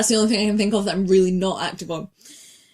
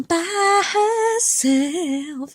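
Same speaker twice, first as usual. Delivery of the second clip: silly voice; 5 semitones higher, 3 dB louder, much slower than usual. Acted. Joking voice at sign-off.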